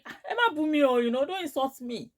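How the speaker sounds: high-pitched indignant warning; retold anger. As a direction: warn them off, acting out the quarrel. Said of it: "That's how I got angry".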